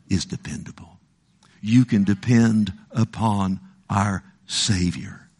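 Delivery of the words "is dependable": whispered "dependable"; hushed conviction. Real, not acted. Preacher assuring the church that Jesus is dependable, whispered. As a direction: drop to a breathy whisper on "dependable". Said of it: "I tell you that Jesus".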